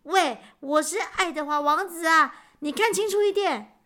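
indignant prince, pitched high. A narrator's reading. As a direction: insist on who you are, voice climbing. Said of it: "王子非常生气地大声说". (生气地提高嗓门)

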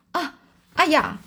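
high, drawn-out gasp of astonishment; storyteller acting a startled "ah, aiya"; hoarse startled cry. A narrator's reading. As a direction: blurt it out slowly in amazed shock. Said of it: "这嘶哑的声音从他颤抖的嘴里".

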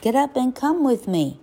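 high, pleading call to a friend; urgent cry. A narrator's reading. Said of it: "cried Bambi".

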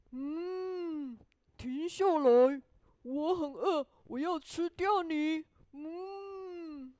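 booming storybook cow with long moos; moo, then demand loudly and heavily; hungry cow. A narrator's reading. Cow stopping the gingerbread man, loud mooing cow voice. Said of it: "这只牛说".

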